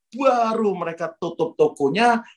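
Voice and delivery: normal talking voice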